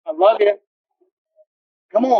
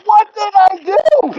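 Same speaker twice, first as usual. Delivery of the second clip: high, loud, slow protest; shouting; 8 semitones higher, 5 dB louder, slower than usual. Real, not acted. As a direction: yell it in disbelief. Man protests while being restrained.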